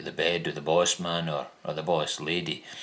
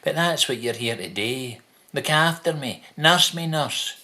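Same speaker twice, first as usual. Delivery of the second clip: higher, wheedling mock patient; mocking needy patient; 8 semitones higher than usual. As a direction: mimic a demanding patient calling for the nurse. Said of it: "he's the sort of dick to come out with".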